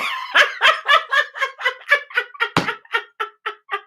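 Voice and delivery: real delighted high laughter; high burst of laughter. Real, not acted. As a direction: crack up at the memory. Laughing at friend's video.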